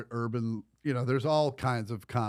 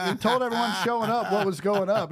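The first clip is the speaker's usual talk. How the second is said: laughing through it; 10 semitones higher, 6 dB louder than usual. Real, not acted. Still laughing while defending him.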